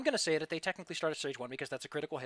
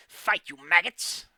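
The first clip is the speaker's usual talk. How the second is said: slow, loud commanding bark; rally the troops with a roar; barking war priest; 4 semitones higher, 9 dB louder, slower than usual. Acted. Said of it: "the war priest".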